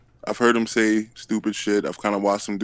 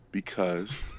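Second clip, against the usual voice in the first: usual speaking voice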